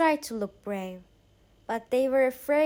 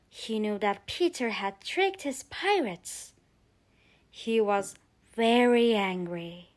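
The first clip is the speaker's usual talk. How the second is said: plain reading voice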